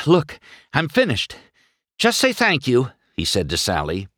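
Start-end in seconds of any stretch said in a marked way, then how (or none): 0.0-2.9 high, out-of-breath insistence; panting heavily. A narrator's reading. McQueen tells Sally he's finished, panting. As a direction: puff it out, pitched up and spent. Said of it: "McQueen panted heavily".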